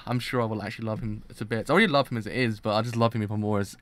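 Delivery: usual speaking voice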